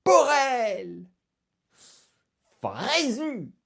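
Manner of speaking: high, loud cry of names; joyful calling out. Acted. Balsu hails his returned friends, calling out high and loud. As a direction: call out loudly to returning friends.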